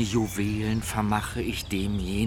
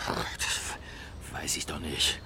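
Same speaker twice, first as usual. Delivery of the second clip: irritated whisper; 4 semitones lower, 7 dB quieter, much slower than usual. Acted.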